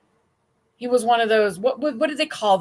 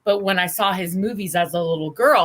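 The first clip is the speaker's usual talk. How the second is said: normal talking voice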